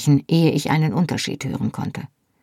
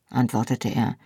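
ordinary delivery

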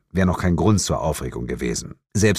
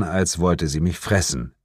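ordinary delivery, nothing marked

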